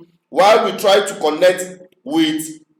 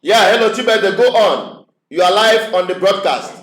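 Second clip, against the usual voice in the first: to someone hard of hearing; 4 semitones higher than usual. Real, not acted. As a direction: raise the voice so the caller hears. Bad phone line, caller can't hear.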